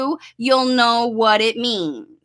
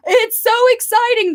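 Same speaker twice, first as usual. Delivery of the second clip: high gasp into squeal; excited gasp; 11 semitones higher, 4 dB louder than usual. Shown as a demonstration. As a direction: gasp with thrilled anticipation. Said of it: "I'm making it so fun".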